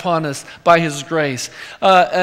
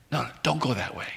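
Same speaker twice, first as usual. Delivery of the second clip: soft warning; 4 semitones lower, 11 dB quieter, much faster than usual. Pretend. Inner voice warning.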